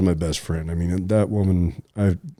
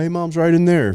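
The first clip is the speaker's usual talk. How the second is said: high, louder eager announcement; retold burst of excitement, pitched way up; excited; 10 semitones higher, 4 dB louder than usual. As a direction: uncle tells relatives mom is waiting, high excited voice. Excited telling relatives about mom.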